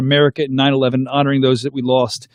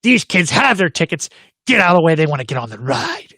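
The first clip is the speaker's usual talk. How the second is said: yelling woman. Retold or imitated. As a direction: lightly mimic a stranger ordering someone aside. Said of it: "like, yelling at me".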